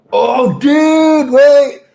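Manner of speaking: sudden high shout, real alarm; high startled outburst. Real, not acted. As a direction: yell it out, caught off guard.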